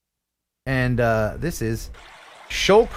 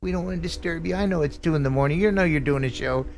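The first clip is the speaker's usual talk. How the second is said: considerate train driver; 2 semitones higher, 5 dB quieter than usual. Acted. Train driver not wanting to disturb the show, gently apologetic. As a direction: go easy on the horn, keeping it quiet. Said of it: "while other guys are like".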